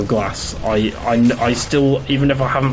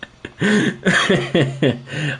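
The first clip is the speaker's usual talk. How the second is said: hearty laugh; 7 semitones higher than usual. Real, not acted. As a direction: burst out laughing. Laughs at own joke.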